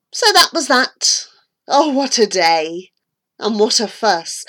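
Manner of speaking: plain reading voice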